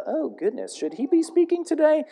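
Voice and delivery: high, fretful pearl-clutching voice; worried churchgoer. Pretend. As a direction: gasp it out like a scandalised church lady. Imagined worried churchgoer reacting.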